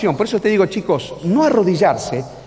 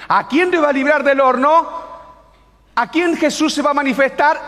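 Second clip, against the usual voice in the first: ordinary talk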